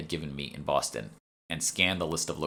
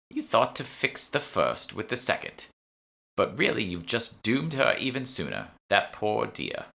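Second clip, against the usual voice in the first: put-on high, slow ominous old woman; grave old shopwoman; 5 semitones higher than usual. Shopwoman revealing the narrator's mistake, slow and grave. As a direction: deliver the doom slowly.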